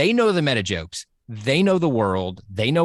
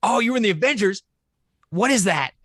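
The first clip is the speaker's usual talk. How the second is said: mock surprise; 8 semitones higher, faster than usual. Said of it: "Tobey Maguire actually being hilarious".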